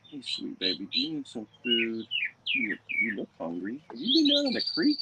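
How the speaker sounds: high, cooing pet voice. Real, not acted. Cooing to the stray cat.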